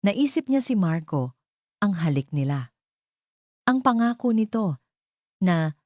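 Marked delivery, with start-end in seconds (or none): none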